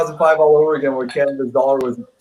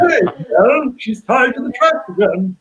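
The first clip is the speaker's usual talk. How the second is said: Mountie hero voice; 9 semitones higher than usual. Retold or imitated. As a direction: ham up an old cartoon Mountie calling for help. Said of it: "He doesn't wear a Mountie uniform".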